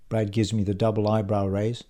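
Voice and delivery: ordinary delivery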